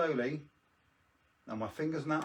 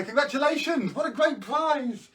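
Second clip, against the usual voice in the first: mock announcer; 12 semitones higher, 9 dB louder than usual.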